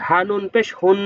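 usual speaking voice

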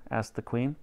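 ordinary delivery, nothing marked

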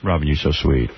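low, soft sweet talk; lightly voiced seductive suitor. Shown as a demonstration. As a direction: woo her gently in a low voice. Host demonstrates sweet talk.

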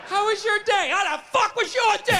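husband home from work snapping at his wife; mock angry husband. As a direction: spit it back at her. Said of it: "download all your insecurities".

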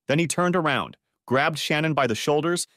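ordinary delivery, nothing marked

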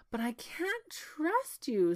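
high, slow princess voice; distrustful princess. A narrator's reading. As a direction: draw out the high-voiced objection. Princess refuses to trust him.

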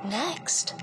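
usual speaking voice

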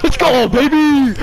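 loud, drawn-out victory shout; excited, celebrating. Real, not acted. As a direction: stretch it out in triumph. Celebrating the win.

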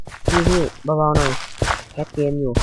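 slow, pleading request to keep it down; retelling a gentle plea to the sister; polite plea. Retold or imitated. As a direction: ask your sister nicely but slowly. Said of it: "ผมก็บอกพี่เลย".

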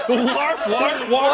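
loud playful barks; loud joking woofs; mock dog barking. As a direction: yap like a silly dog. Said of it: "I forgot what a dog sounded like".

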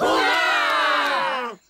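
loud joyful cheer. Acted. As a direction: shout hooray with relief. Cheering the good news.

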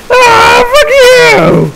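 enraged high-pitched yell at the zombies; furious shout. Real, not acted. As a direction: scream it at the horde. Raging at zombies.

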